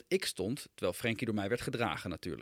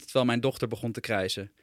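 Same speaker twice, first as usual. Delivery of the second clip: ordinary delivery, nothing marked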